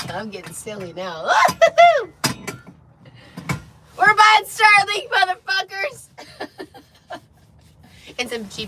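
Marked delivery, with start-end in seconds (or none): 1.4-2.7 loud laugh. Real, not acted. Laughing at own joke. 4.0-6.1 high, loud gleeful yell; triumphant shout. Real, not acted. Triumphant outburst on stream.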